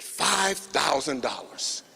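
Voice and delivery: drawn out, slow price reveal; slow dramatic price announcement. Retold or imitated. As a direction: savour each word like a big announcement.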